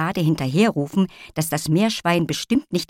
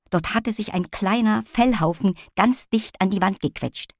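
ordinary delivery

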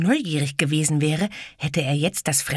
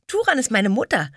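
giggling; 8 semitones higher, 4 dB louder than usual. Acted. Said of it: "die Kleine kicherte".

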